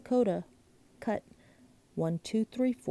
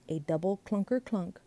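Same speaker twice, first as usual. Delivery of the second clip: ordinary delivery, nothing marked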